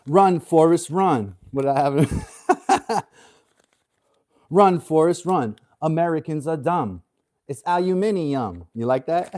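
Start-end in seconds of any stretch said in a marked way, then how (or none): 0.1-1.3 louder mock British accent; hammed-up British chant. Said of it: "does a better British accent". 4.5-8.7 mock British accent, a bit louder; hammed-up British accent. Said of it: "does a better British accent".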